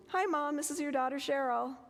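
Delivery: a touch of sing-song cheer on the phone; cheerful phone greeting. Retold or imitated. Daughter greeting her mother on the phone, lightly bright.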